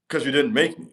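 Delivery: ordinary talk, nothing marked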